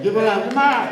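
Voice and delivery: loud high call of come on, give it up; crowd-rousing shout; hyping the crowd. Real, not acted. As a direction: whip up the applause.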